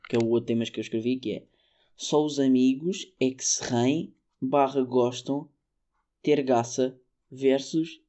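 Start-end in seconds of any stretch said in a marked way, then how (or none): 2.0-8.0 read as written. Shown as a demonstration. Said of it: "vou ler como isto está escrito".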